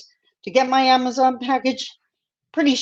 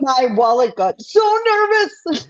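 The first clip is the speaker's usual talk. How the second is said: laughing joke; 5 semitones higher, 3 dB louder than usual. Real, not acted. Joke about spending.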